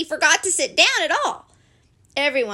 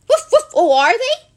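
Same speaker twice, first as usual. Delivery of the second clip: high, loud barks and rising question; yap, then ask in keen surprise; excited dog, pitch jumping up; eager talking dog; 12 semitones higher, 9 dB louder than usual. A narrator's reading. Toby the dog reacts eagerly.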